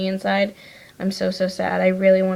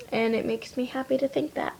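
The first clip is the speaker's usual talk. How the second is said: ordinary talk, nothing marked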